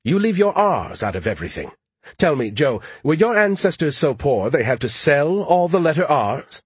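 lightly voiced superior tease; mocking know-it-all. Skippy teases Joe about his accent, mocking.

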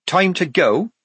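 high, firm command; tone of an order. A narrator's reading. Polly to the ferryman, ordering. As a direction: suggest it as an order. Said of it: "in the tones of an order".

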